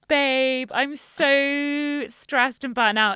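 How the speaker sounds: loud, slow, laughing through it; mock stressed friend. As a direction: ham up a frazzled friend venting. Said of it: "we both came here being like".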